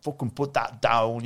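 slow angry Irish barmaid; Irish accent. Retold or imitated. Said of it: "and in her Irish accent".

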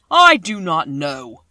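a vast, slow, thundering wizard; voice of the great Oz. A narrator's reading. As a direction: boom each word out like a huge magic head.

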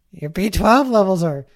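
doctor voice ending in a loud dud sting. Pretend. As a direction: deliver the bad news, then boom. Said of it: "I'll do both voices".

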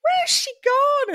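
high, slow, mock-bewildered call; high drawn-out mock puzzlement; puzzled exclamation. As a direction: act baffled finding the tent empty. Imagined morning search of tent.

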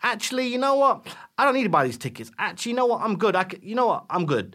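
breezy high voice turning it down; casual tough guy. Pretend. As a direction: shrug it off, unbothered.

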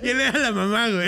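high, loud, through laughter; laughing. Real, not acted. Host repeating the absurd Loki detail to co-host, laughing hard. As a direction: repeat it cracking up.

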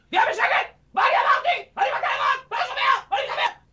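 put-on screaming way up high at the kid; shouting at kid. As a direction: yell at the child in a shrill high voice, losing it. Grandpa yells at his child. (צעקה גבוהה על הילד)

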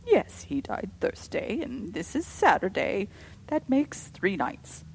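stiff and slow, each word held in check; holding herself calm by force of will; rigid. A narrator's reading. Caroline coldly counting the nights to her sisters, rigid. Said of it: "said Caroline rigidly".

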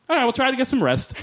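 high-pitched, oddly delivered sign-off; weird line read. Retold or imitated. Doctor signs off to patient who rolled over, in a high, weird read. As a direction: imitate an actor's odd, high line read. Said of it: "That's literally how he says it".

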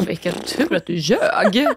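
slow, knowing inner voice; sly aside. Retold or imitated. Friend voicing her inner thought about the lie, slow and sly. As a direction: savour the irony. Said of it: "Du bara".

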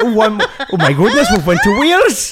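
put-on high-pitched accent sliding into Welsh; laughing, botched Geordie turned Welsh; failed Geordie accent. Storyteller losing the Newcastle accent, botched Geordie-Welsh imitation. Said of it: "I can't do the accent".

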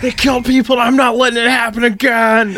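angry outburst. Retold or imitated. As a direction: explode at them. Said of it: "And then it's like".

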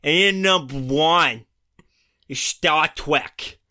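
a bad American accent, hammed up and slow. Ranking read to his co-host in a bad American accent. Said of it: "So I'll just do my American accent then".

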